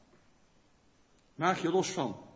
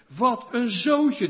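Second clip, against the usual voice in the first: slow, louder, higher scornful exclamation; disgusted thought; 6 semitones higher, 6 dB louder, much slower than usual. Retold or imitated. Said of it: "denk ik dan". (traag, luider en hoger, vol afkeer)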